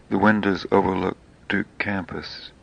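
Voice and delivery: ordinary delivery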